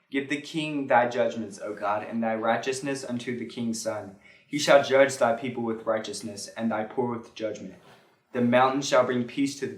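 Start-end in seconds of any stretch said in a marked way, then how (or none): none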